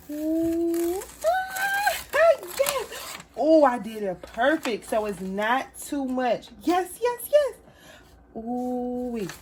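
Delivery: high, loud delighted squealing; excited squeals. Real, not acted. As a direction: squeal with joy at the reveal. Reveal of her glowing craft.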